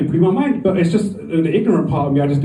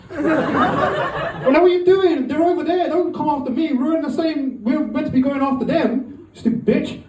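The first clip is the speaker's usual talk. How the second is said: mock-exasperated yelp, much higher; high indignant retort; 11 semitones higher than usual. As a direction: squeal it like you can't believe she's doing this. Comic quarrel with woman in trench.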